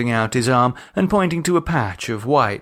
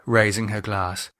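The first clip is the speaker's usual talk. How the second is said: ordinary delivery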